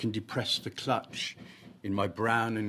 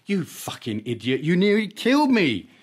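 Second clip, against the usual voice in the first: furious shout; 10 semitones higher, 11 dB louder than usual. Acted. Girlfriend after car crash.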